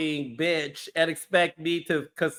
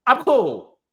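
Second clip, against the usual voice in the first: imitating tough woman; 2 semitones higher, 5 dB louder than usual. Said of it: "she's sort of like".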